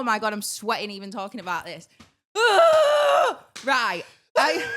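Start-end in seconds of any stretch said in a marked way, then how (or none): 2.3-3.6 loud, high-pitched exhale; exasperated sigh. Real, not acted. Flustered while telling a mortifying story.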